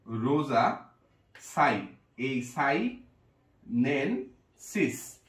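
slow, piece by piece; broken into syllables. Shown as a demonstration. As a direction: spell it out syllable by syllable. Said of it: "এভাবে যদি ভেঙে ভেঙে পড়ি আমরা". (ধীরে, ভেঙে ভেঙে)

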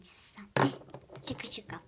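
a quick whispered aside to herself. Real, not acted. Talking to herself over the drawing, whispered. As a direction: breathe the words with no voice.

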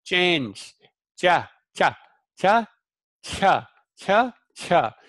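"cha" with a strong puff of breath; breathy cha. Shown as a demonstration. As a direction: push air through each "cha". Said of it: "putting a lot of breath into that".

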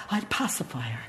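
whisper. Acted. Hushed aside.

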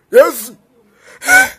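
sudden loud cry of Jesus, then a very high yelp; shocked cry. Real, not acted. Seeing something startling on the road, shocked cry. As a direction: gasp out loud at the sight.

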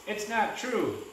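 high-pitched, moderately forceful denial; mild shout. A narrator's reading. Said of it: "Jackson shouted".

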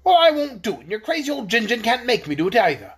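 loud, high defiance that settles into scorn; king refusing the dragon's command; defiant shout. Acted.

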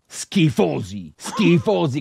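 high, drawn-out mock condemnation; disgusted outburst. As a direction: wail the insult in hammed-up disgust. Mock disgust at Latin phrases.

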